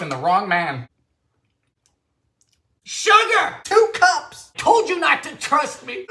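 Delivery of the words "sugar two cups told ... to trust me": exasperated outburst. Real, not acted. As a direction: yell at yourself for the mistake. Scolding himself over a recipe blunder.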